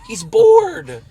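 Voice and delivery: very high, loud outburst; emphatic exclamation. Real, not acted. Fan insisting the rapper is too good, high excited shout. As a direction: shout it in high-pitched disbelief.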